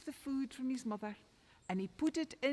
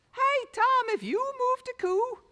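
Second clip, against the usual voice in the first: loud, high call to her son; mother calling her son about the cow; 13 semitones higher, 9 dB louder than usual. Acted. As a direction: shout the question across the yard.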